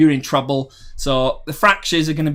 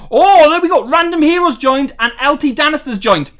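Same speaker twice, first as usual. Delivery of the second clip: higher, louder, thrilled at new members; excited; 11 semitones higher, 7 dB louder than usual. Real, not acted. Streamer sees viewers join the Discord, excited. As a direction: cheer as names pop up.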